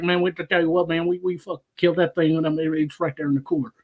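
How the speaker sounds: rapid Southern mumble; Boomhauer. Retold or imitated. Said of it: "It got to Boomhauer".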